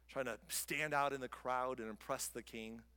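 ordinary talk, nothing marked

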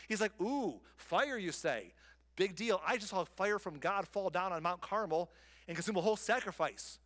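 mock bored bravado; unimpressed Elijah. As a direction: shrug off the fire and wind. Elijah's dismissive retort voiced.

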